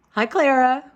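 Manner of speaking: high, loud sing-song pet greeting; talking to a pet. Real, not acted. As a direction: greet the cat brightly. Greeting her cat.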